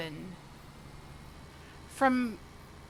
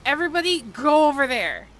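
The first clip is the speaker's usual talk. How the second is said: loud, commanding shout to clear the beach; angry yell; 4 semitones higher, 9 dB louder, faster than usual. Retold or imitated. Boyfriend on the beach yelling at everyone, angry shout. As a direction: bark the order like you're furious. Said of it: "And he's all pissed off".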